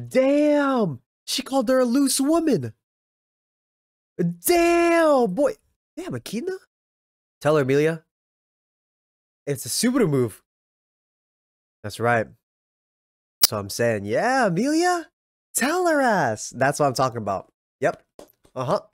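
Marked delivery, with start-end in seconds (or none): 0.1-6.6 shouted amazement at the insult; shocked hype. Real, not acted. 14.0-16.5 cheering on. Real, not acted. Cheering character on.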